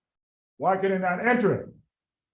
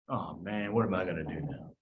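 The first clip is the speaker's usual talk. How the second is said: dejected guy; 10 semitones lower, 7 dB quieter than usual. Acted. Pyruvate dejected outside club.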